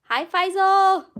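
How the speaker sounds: high, loud, drawn-out hello; excited greeting. Real, not acted. Greeting a viewer on stream.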